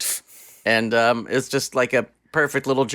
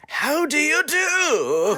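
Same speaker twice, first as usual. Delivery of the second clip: high and very slow How do you do with a rising end; drawled cowboy greeting; much slower than usual. Shown as a demonstration. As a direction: stretch the greeting out like a lazy cowboy. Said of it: "Or you can go".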